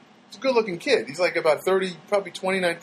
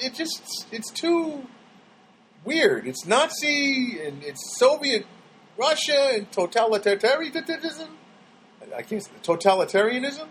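normal talking voice

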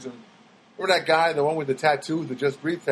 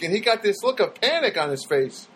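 ordinary talk, nothing marked

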